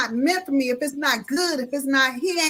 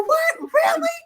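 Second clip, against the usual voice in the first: very high squeal of surprise; astonished; 3 dB louder, slower than usual. Retold or imitated. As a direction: imitate a woman squeaking in amazement. Retells woman's surprised reaction.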